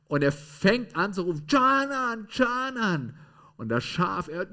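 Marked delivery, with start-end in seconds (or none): 1.5-3.2 high, loud call across the stalls; boy calling his lamb among four hundred sheep. Acted. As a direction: call the sheep by name, drawn out.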